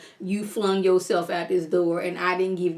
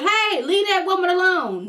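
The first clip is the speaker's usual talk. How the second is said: mock yell; 10 semitones higher, 4 dB louder than usual. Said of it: "nobody even so much as yelled".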